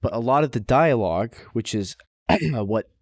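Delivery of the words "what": ordinary talk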